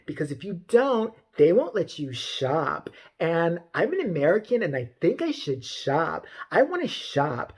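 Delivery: slow, drawn-out mocking customer voice; hammed-up imitation of entitled disbelief; mock entitled Karen. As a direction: drag each phrase like an affronted shopper.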